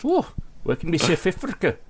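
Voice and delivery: high South African attempt; mock South African accent. Co-host suggesting the next accent, mock South African. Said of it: "only bit of the accent".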